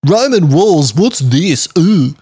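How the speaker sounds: high, loud, exaggerated oohing; mocking John impression. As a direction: imitate a friend's wonder, hammed up. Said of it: "John saw the map ... referencing and went".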